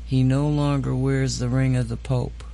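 normal talking voice

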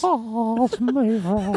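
put-on high, thin old man voice; frail old man voice. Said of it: "it sounded like a frail old man".

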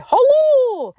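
Hello leaps very high and is held long, then falls; a long, high, sung-out Hello; Ian's hello. Retold or imitated. Imitating her late Scottish friend's high drawn-out Hello. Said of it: "he'd say".

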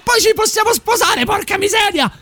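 high-pitched yell; excited shout. Real, not acted. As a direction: let it rip up high. Joking proposal shouted again.

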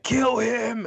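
sudden high shouted "Kill him!"; mock crowd yell. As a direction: bark it like an underground fight crowd. Yelling fight crowd chant.

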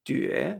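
"Jue" with a rising glide; drawn-out rising "Jue"; second tone. Shown as a demonstration. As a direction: lift "Jue" like a question. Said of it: "segundo tono".

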